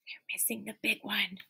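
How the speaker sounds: hushed worried whisper; whispered suspense. Acted. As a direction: tease the kids with the secret. Suspense aside to kids.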